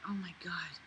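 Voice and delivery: exasperated whispered oh my god; a disbelieving whisper. Real, not acted. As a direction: breathe it out unvoiced. Disbelief at the writing.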